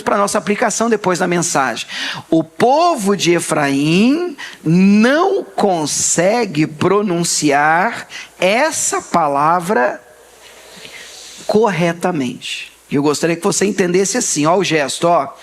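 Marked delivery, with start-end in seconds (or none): 2.3-12.7 slow, deliberate repetition; slow repeat. Shown as a demonstration. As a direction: lay every word down slowly. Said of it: "Vamos repetir essa frase". (repetição lenta e pausada)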